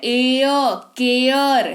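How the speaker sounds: एयर stretched, then केयर; vowel sound demo. Shown as a demonstration. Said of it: "एयर सुनाई दे रहा है". (एयर की ध्वनि खींचकर)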